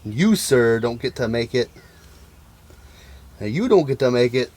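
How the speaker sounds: normal talking voice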